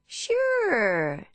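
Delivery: high, loud Texan woman; Texan accent. A narrator's reading. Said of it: "in an accent similar to Deborah's Texan friend".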